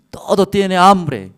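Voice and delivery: loud, high, drawn-out acted protest; exasperated king's retort. King telling the woman everyone is starving, loud acted exasperation. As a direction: stretch the complaint out at full voice.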